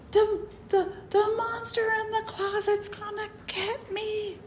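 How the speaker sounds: put-on a terrified six-year-old sobbing it out; hysterical child. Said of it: "And I'm hysterical".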